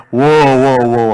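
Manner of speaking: loud, drawn-out whoa to a horse; slow, booming mock cry; retold yell. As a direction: act out yelling for a runaway horse to stop. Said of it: "소리를 쳤지만".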